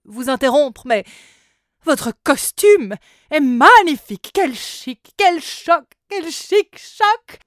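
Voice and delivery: very loud, high theatrical seller; flamboyant salesman. A narrator's reading. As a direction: gush like a fashion dealer. (vendeur extravagant, très fort)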